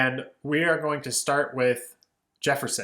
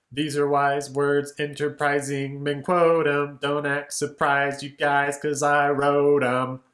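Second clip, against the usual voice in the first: louder, wrote stretched out and hit; syncopated groove; 3 dB louder than usual. Shown as a demonstration. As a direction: swagger the humble brag in syncopation. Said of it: "Very syncopated, very groovy".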